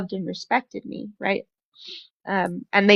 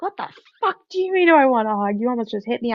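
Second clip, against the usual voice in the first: retold outburst; 4 semitones higher, 3 dB louder, faster than usual. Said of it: "I go off on him".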